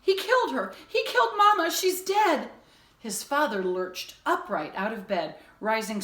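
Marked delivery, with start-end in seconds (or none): 0.0-2.5 much higher and louder, near screaming; desperate shrieking cry; frantic shout. A narrator's reading. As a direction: scream it at his dad. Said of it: "shouted it all again".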